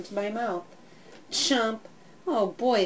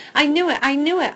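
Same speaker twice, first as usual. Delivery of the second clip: excited barking; 6 semitones higher, 6 dB louder, faster than usual. A narrator's reading. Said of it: "I bark, spinning in circles".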